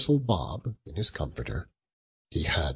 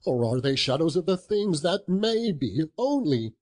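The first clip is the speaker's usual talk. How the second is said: desperate plea; 7 semitones higher than usual. Acted. Scrooge begs the ghost at the grave, high and desperate. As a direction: beg for a different future.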